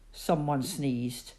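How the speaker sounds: usual speaking voice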